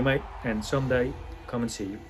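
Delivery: ordinary talk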